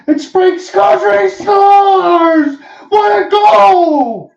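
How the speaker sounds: huge drawn-out goal call, high and loud; long goal yell. Real, not acted.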